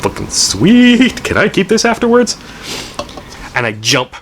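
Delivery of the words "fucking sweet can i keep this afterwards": put-on thrilled like a kid with a new toy; excited squeal. Young hunter gleeful over his glowing knife, excited squeal.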